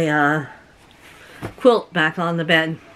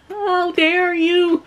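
high, drawn-out indignant cry; hammed-up high "how dare you" indignation; mock outrage; 9 semitones higher, much slower than usual. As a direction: scold the bug in put-on shock. Acting out outrage at bug.

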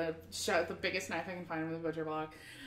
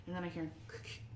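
ordinary talk, nothing marked

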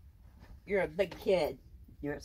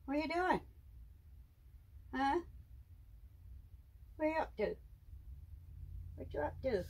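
high, cooing questions to her pet; 10 semitones higher, 5 dB quieter, faster than usual. Real, not acted. Owner cooing at her pet snake in high baby talk.

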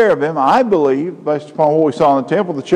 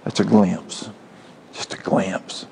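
soft, awed whisper; hushed whisper; 6 semitones lower, 7 dB quieter, slower than usual. Real, not acted. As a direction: breathe it out in wonder. Hushed awe after the climax.

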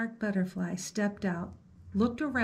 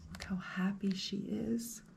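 1 soft aside; 9 dB quieter than usual. Real, not acted. Soft aside to listening child.

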